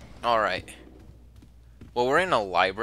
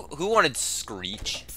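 ordinary talk

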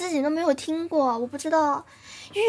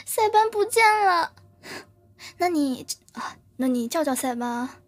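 ordinary talk, nothing marked

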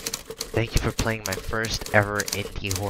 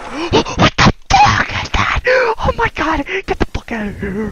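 drawn-out high wail of panic, easing slightly; slow, high panicked cries; panicking; 5 dB louder, slower than usual. Real, not acted. Player sees something awful in the game, panicking wail.

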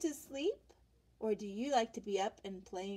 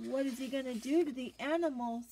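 usual speaking voice

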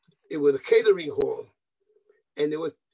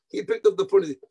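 ordinary talk

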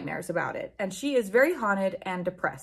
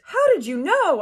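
high, loud hammed-up gasp of a question; mock surprise; 8 semitones higher, 6 dB louder than usual. A narrator's reading. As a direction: overplay the shock. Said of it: "I asked in a surprised way".

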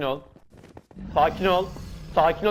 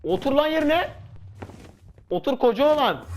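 high taunting yell; 8 semitones higher than usual. Real, not acted. Taunting opponent mid fight.

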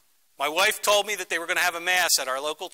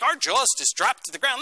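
usual speaking voice

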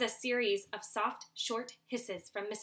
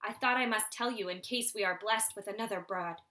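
ordinary delivery, nothing marked

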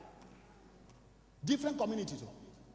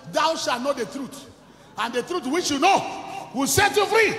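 preaching scripture; 4 semitones higher, 7 dB louder, slower than usual. Acted.

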